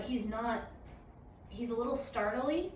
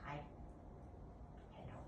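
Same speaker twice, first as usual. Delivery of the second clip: much softer coaxing puppy voice; soft pet talk; 3 semitones higher, 10 dB quieter than usual. Real, not acted. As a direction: coax the puppy gently. Inviting puppy to play softly.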